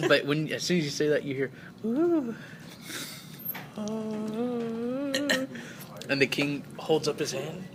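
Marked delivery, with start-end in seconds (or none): 1.9-5.1 high, drawn-out moan; pained groan. Acted. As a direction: let out a high wavering "ooh". Guard's moans voiced in game.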